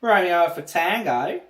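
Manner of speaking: put-on booming, slow Australian accent. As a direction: belt it out like a proud Aussie. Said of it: "my Australian accent's all right".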